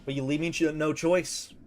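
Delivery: normal talking voice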